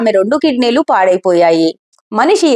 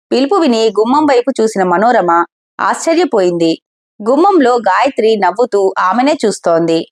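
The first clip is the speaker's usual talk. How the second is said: plain reading voice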